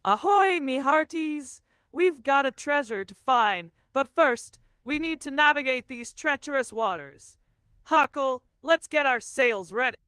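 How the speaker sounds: hearty pirate captain hailing his crew. Acted. AI plays pirate captain for the streamer, hearty. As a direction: boom out a cheery ahoy, pirate style.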